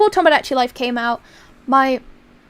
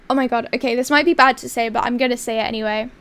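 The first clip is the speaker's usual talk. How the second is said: ordinary talk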